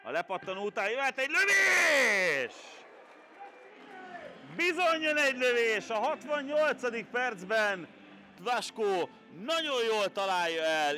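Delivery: loud, high goal commentary; excited goal call. Acted. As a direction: build to the goal and hold it. Goal scored live.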